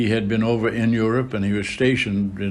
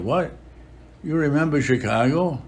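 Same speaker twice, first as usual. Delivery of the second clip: higher, amazed disbelieving repeat; astonished; 5 semitones higher than usual. Retold or imitated. The GI echoes Padre Pio's question, higher and astonished. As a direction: echo it back in startled disbelief. GI stunned by the priest's remark.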